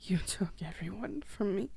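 quiet, wounded accusation; quiet grieving accusation; hushed accusation. Acted. Victim confronts killer.

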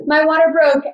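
startled cry of news; startled announcement. Retold or imitated. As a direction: blurt it out as you jolt awake. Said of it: "jolt up and I go".

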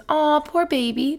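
high, slow pitying coo; cooing sympathy. Retold or imitated. Teacher reads out her wounded-deer answer, cooing imitation. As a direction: coo it like comforting a hurt little animal.

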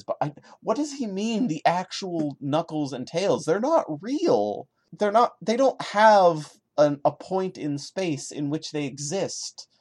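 can't believe what he just read; exasperated disbelief. Real, not acted. Reacting to the author's comment, exasperated and incredulous. Baffled at the writer's claim.

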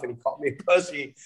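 usual speaking voice

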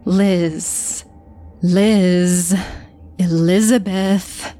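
drawn-out ghostly hiss on each name; eerie phantom name-calling. A narrator's reading. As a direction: hiss it slowly, with long pauses between. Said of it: "hissing my name over and over".

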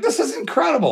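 high-pitched thrilled exclamation; retold amazement. Son marvelling at sitting in the fighter's corner, excited high voice. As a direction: re-enact disbelief at your luck, pitched well up.

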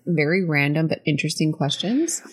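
ordinary delivery

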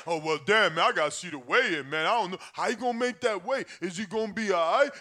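mock a wavering bettor, voice dropped; hesitant bettor. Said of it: "afraid to bet".

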